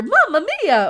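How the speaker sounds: high, loud Italian plumber cry; cartoon Mario shout. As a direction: belt out Mario's catchphrase. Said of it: "just to hear that voice over and over".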